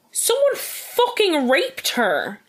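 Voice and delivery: slow, high, indignant stress on each word; real fury held in; outraged. Real, not acted. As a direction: spell out the outrage slowly.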